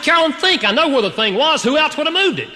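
mock furious husband demanding answers; retold yelling at wife. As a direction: snap at her like she lost it. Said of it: "I was screaming at my wife".

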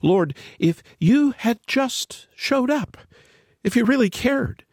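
high, slow, hurt plea to God; a lightly acted complaint, pitched high; pleading prayer. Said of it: "repeated this prayer".

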